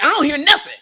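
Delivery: loud mock husband waving off the wife; mock dismissive husband. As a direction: play the oblivious husband, half-joking. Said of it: "they're like".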